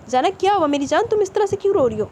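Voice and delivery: an anxious lover asking why she cries. A narrator's reading. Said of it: "بے چینی سے بولا". (بے چینی سے پوچھنا)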